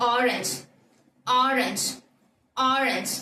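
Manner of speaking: "ஆரஞ்சு" begun with a broad, long "aa"; open-mouthed first vowel. Shown as a demonstration. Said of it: "mouth open ஆகுது இல்லையா". (வாயைத் திறந்து "ஆரஞ்சு")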